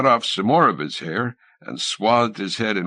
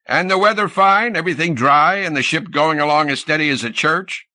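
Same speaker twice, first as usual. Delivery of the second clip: gruff; 7 semitones higher, 4 dB louder than usual. A narrator's reading. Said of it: "pursued Captain Johns gruffly".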